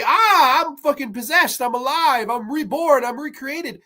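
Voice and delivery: high-pitched, hammed-up revival cry; over-the-top back-from-the-dead announcement; mock possessed exclamation. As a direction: exclaim like a puppet brought to life. Acting out revived maid in finale.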